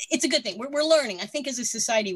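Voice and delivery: ordinary talk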